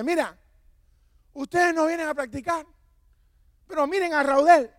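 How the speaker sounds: high, loud coach's shout at the team; coach yelling. Retold or imitated. Coach shouting at the twelve runners, loud yell. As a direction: bellow it like a coach scolding runners. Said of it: "él empezó a gritar".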